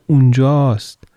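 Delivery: light up and call out; girl spots her lost shoe across the street; joyful cry. Acted. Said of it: "با شادی فریاد زد".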